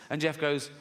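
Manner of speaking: ordinary talk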